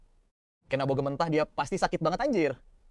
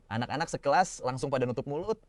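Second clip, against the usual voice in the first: plain reading voice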